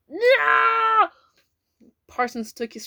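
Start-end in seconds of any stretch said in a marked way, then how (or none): 0.1-1.1 high, loud horrified No; shocked shout. Acted. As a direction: cry out in disgust.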